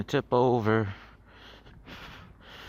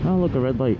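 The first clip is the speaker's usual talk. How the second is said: normal talking voice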